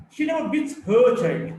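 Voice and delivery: "her" punched high and loud; stress on HER. Shown as a demonstration. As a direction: make it HER child, not someone else's. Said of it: "she beats someone else's child".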